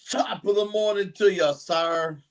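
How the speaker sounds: cheery stage-Irish hello; Irish accent. Pretend. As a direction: play up a hammy Irish brogue. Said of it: "I'm not really Irish".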